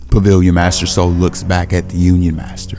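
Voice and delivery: usual speaking voice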